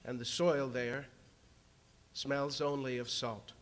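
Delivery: ordinary delivery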